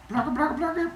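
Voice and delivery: high glugging mouth sound; pouring noise. Acted. Zeus pours the Mountain Dews, glugging noise. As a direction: make the soda pour sound.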